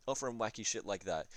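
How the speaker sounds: usual speaking voice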